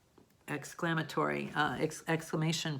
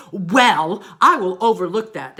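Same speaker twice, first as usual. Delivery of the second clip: loud, overdone outburst; strong interjection; 2 semitones higher, 14 dB louder than usual. Shown as a demonstration. Teacher showing how the sentence does not sound, loud exclaiming. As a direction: blurt it far too forcefully, very loud. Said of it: "it's not like".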